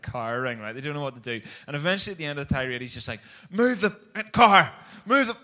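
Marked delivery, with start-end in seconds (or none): 3.5-5.4 imitated furious yell, loud and high; angry shouting. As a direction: bellow it like a man losing it. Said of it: "And he just shouts".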